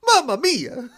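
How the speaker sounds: a high, hammed-up Italian "Mamma mia"; jokey Italian exclamation; mock Italian accent. Moe's inner child blurts it in mock Italian. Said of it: "Moe's like".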